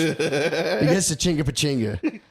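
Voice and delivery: chuckling over Chinga Pachinga; amused. Real, not acted. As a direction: savor the silly name. Laughing at the village name.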